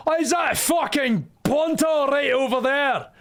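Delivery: bad Scottish accent. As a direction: put on a jokey, overdone Scottish accent for the chat. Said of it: "I'm not doing an Irish accent at this point. That's just a really shit Scottish".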